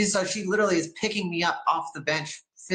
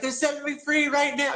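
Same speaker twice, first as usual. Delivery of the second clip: much higher, thrilled cry; excited; 10 semitones higher than usual. Retold or imitated. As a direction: blurt it out with joy. Excited at noon in cell.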